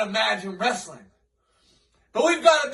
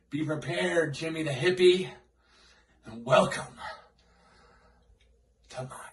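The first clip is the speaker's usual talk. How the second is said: menacing low hush; 6 semitones lower, 5 dB quieter, faster than usual. Acted. Villain promo threatening opponent.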